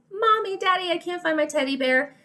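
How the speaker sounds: put-on high-pitched plaintive child's voice; little child calling parents. Susie tells parents her teddy is missing, high child voice. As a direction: call out high like a child who needs help. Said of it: "And she's telling you".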